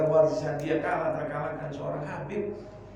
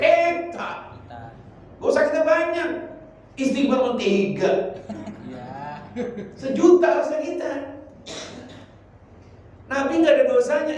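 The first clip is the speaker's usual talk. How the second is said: fiery preaching; 7 semitones higher, 6 dB louder than usual. Acted. Preacher urging the congregation to repeat istighfar, loud and fervent. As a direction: preach it out to the whole room.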